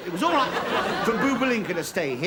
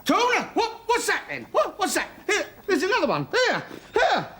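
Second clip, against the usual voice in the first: high-pitched startled outbursts; alarmed yelping; 10 semitones higher than usual. Acted. Man finding insects on himself, alarmed yelping. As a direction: yelp at each new bug you find. Finds insects crawling on him.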